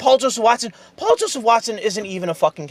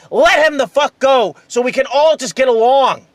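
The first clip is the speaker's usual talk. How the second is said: ordinary talk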